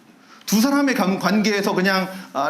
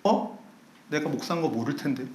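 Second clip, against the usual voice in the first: startled murmur; 4 semitones lower, 7 dB quieter than usual. Retold or imitated. Pastor startled, thinking aloud.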